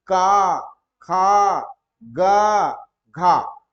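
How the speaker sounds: का, खा, गा, घा punched out with long vowels; letter demonstration. Shown as a demonstration. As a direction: sound out each syllable loudly and separately. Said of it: "बोल करके देखिए कहाँ पे इसका प्रभाव".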